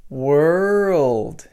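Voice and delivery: put-on "world" said as one linked "were-old"; "world" with the linking sound. Shown as a demonstration. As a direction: blend "were" into "old" smoothly. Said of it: "Can you hear that?".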